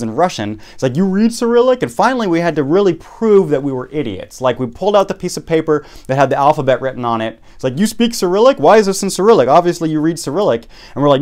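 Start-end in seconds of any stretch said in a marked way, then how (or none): 1.0-1.8 much higher, accusing question; suspicious officer. Retold or imitated. 7.8-10.7 high, louder, accusing border guard; accusing officer. Retold or imitated.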